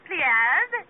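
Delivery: usual speaking voice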